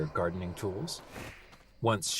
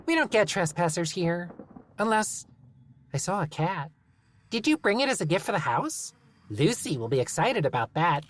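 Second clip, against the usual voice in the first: high, cheerfully menacing gnome; 9 semitones higher than usual. Acted. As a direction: sweetly threaten to bury him. Gnome chatter.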